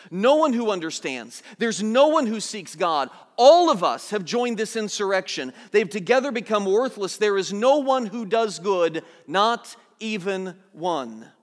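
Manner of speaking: preaching. Acted. Preacher declaring scripture to the church, building preaching. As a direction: preach it with rising force. Quotes Scripture with rising force.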